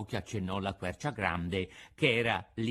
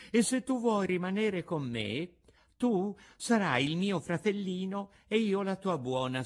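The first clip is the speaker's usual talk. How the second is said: higher-voiced, lightly played fairy; kindly fairy; 8 semitones higher than usual. A narrator's reading. Fairy asks puppet to stay as brother, kindly. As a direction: invite gently as the fairy.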